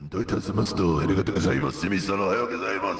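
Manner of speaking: put-on low, formal Japanese-accented voice; bow and speak like a businessman. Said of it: "speak like a Japanese businessman".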